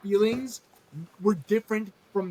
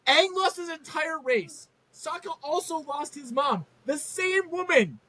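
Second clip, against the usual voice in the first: high-pitched rant climbing to a squeal; escalating outrage; 6 semitones higher than usual. Pretend. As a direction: build the exasperation, pitch rising steeply. Building rant at Katara.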